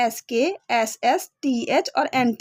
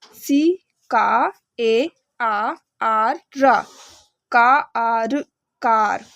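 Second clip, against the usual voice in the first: sounding out letter by letter; 2 semitones lower, much slower than usual. Shown as a demonstration. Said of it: "तो उनको ऐसे पढ़ना है".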